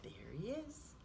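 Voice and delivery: ordinary delivery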